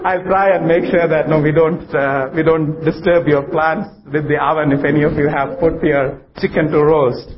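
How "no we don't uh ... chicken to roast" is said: ordinary talk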